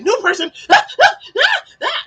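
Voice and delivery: loud, high excited panting yelps; adrenaline-charged dog. Retold or imitated. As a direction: imitate a dog frantic over a newcomer. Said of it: "like, adrenaline. He's like".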